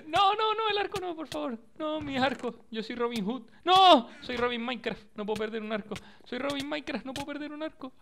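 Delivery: high, pleading mock whine; whiny high voice. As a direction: whimper like a spoiled kid. Whining over losing his bow.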